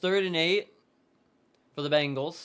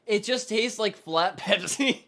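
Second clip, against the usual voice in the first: laughing; 7 semitones higher, 5 dB louder, slower than usual. Real, not acted. Laughs mid-sentence tasting soda.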